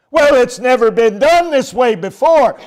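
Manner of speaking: high, loud pleading why; whiny objector. Retold or imitated. Preacher voicing a member demanding reasons, high and whiny. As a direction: whine like a stubborn churchgoer. Mock dialogue with congregant.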